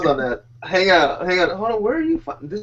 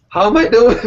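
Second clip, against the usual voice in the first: higher, louder playful ask; a swimmer calling out for feedback; acted question; 8 semitones higher, 8 dB louder than usual.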